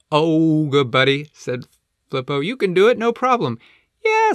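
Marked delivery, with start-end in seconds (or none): 0.1-1.3 loud, hearty penguin cheer; Flippo. Acted. Flippo encourages Flip Flop. 2.4-3.6 high, loud, encouraging penguin; Flippo. Acted. Flippo encourages Flip Flop. 4.0-4.3 very high, loud, excited penguin; squeaky penguin cheer; Layla. Acted. Layla cheers Flip Flop on.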